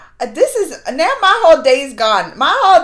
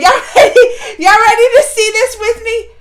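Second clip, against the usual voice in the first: laughing, louder and higher hype; giddy hype; 5 semitones higher, 7 dB louder than usual. Real, not acted. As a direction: whip the viewers up, giggling. Hyping viewers before the ad.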